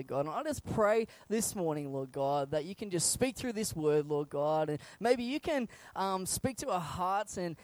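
soft, low prayer voice. Real, not acted. Pastor praying softly before the sermon. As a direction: pray quietly over the congregation.

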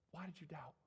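soft, let-down question after the peak; hushed question. Acted. Jesus to Peter who doubted, very quietly. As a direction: drop to a very soft voice.

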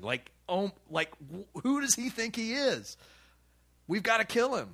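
high, indignant mock outrage from the temple leaders; outraged leaders. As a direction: play the scandalized priests, shocked and angry. Leaders outraged at Jesus' act.

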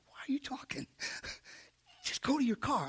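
whispered self-scolding, soft and hushed; hushed inner voice, half amused; inner self-talk. Retold or imitated. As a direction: whisper it to yourself as a private thought. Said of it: "I'm talking to myself on the inside".

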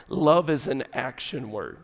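low, soft aside; quiet statement. Shown as a demonstration. Preacher advising the fellas on love, quiet and low. First, understated statement of key line.

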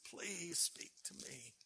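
breathy whisper, slow and broken; begging in a hushed whisper; whispered plea. Retold or imitated. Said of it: "I began to sob".

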